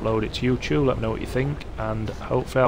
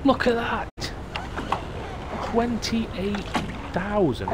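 much higher, delighted surprise; amazed; 11 semitones higher, much slower than usual. Real, not acted. Gamer seeing the big tuna sale price, high and amazed. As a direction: gasp at the price in disbelief.

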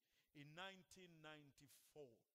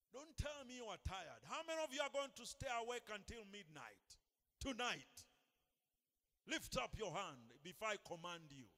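loud, higher challenge to the crowd; preaching shout; 4 semitones higher, 9 dB louder than usual. Real, not acted. Preacher challenges congregation to stay awake, shouting. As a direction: call them out at full voice.

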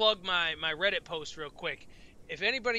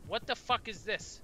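ordinary talk, nothing marked

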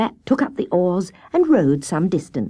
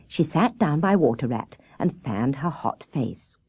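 usual speaking voice

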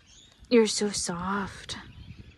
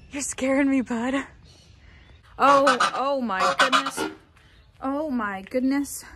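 ordinary delivery

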